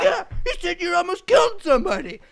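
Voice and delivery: very high, loud accusation; imitated irate foreman. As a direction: yell the accusation as the boss.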